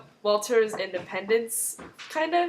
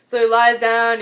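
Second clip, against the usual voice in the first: ordinary delivery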